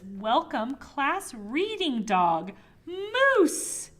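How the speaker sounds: big bright welcome, Moose hit high and loud; cheery welcome. Acted. Reading the sign that welcomes the dog, excited and loud. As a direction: cheer it like a banner greeting.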